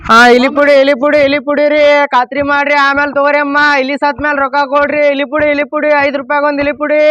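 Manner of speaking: sing-song hawker's call on a steady pitch; street vendor's cry. Acted. Seller hawking rat poison to the street, vendor's cry. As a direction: call out your wares to passers-by, drawing out line ends.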